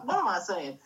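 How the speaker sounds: usual speaking voice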